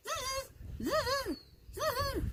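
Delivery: very high, soft buzzing zzz; monkey chatter. A narrator's reading. Monkeys mocking the peddler with high buzzing. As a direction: mimic cheeky monkeys buzzing back.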